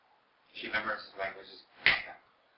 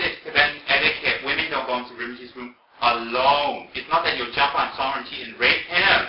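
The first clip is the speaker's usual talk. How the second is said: loud, slow insistence; emphatic explanation; 3 semitones higher, 11 dB louder than usual. Retold or imitated. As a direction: spell out the rule forcefully. Arguing back in acted quarrel.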